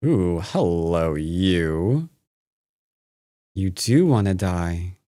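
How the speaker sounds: slow sinister greeting; creepy villain. Pretend. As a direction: purr it slowly like a villain.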